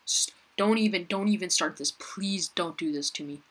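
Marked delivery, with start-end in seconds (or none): none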